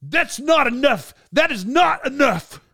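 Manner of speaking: high, slow, emphatic mock rebuke; imitated yelling. Star refusing crew's apology, mock yelling. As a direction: act out an irate star, stressing each word. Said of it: "he's yelling at 'em".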